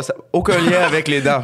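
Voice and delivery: laughing. Real, not acted. Laughs while joking about being high.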